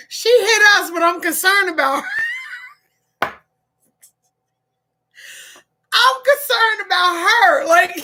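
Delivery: high, laughing through the words; laughing at her own concern for the woman who hit them. Real, not acted. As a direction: crack up while saying it.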